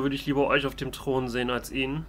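normal talking voice

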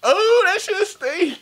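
loud, high, drawn-out outcry; hammed-up stink reaction; mock disgust. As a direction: cry out as if hit by a stench. Pretending the feet reek.

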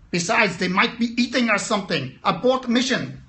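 mock German accent. The amygdala warning against making calls, in a light mock German accent. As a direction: play the overprotective inner voice in a hammed-up German accent. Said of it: "I don't know why my amygdala is German".

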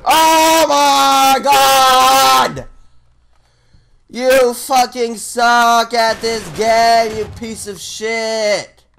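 slow, loud exasperated yell; raging gamer. Real, not acted. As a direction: drag out the insult furiously. Raging at teammates.

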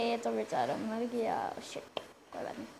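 low, half-whispered letdown; hushed regret. Real, not acted. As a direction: trail off into a soft, resigned whisper. Mourns a dead game animal softly. (दबी आवाज़ में अफ़सोस)

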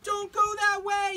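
very high, loud, slow warning cry; tiny chickpea shouting high and loud; chickpea yelling a warning. Acted. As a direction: warn her urgently from afar. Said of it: "yelled a chickpea".

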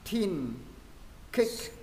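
"Thin", "Kick" said neatly in one go; clear, tidy pronunciation. Shown as a demonstration. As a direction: model "Thin", "Kick" distinctly. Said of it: "mình sẽ đọc gọn rồi". (đọc "Thin", "Kick" gọn, rõ)

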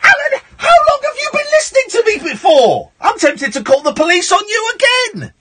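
very high, exasperated shouting; mock outrage. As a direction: berate the caller in hammed-up indignation. Host mock-berates caller over football.

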